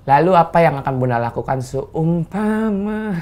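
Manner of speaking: stretched-out presenter delivery; mock dramatic host. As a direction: ham up the interviewer's solemn question. Mock presenter question, then laughs it off.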